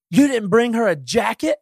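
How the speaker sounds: high, slow, accusing wife's voice; mock wife scolding. Quoting his wife's reproach at Home Depot, high and accusing. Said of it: "Bridget ... goes".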